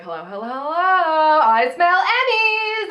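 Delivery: hammed-up showbiz greeting; imitating Rue's grand entrance. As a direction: sweep in like a diva smelling victory. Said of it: "she's like".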